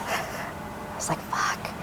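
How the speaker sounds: unvoiced whisper of dismay; whispered curse. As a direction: breathe the curse under your breath. Whispered reaction.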